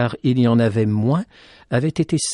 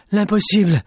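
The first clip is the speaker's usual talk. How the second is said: delirious cry; 7 semitones higher, 5 dB louder than usual. Acted. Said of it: "Dans son délire".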